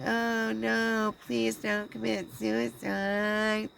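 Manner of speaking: high, slow, pleading mock voice. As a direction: ham up a begging plea. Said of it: "start saying".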